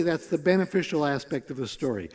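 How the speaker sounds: ordinary delivery, nothing marked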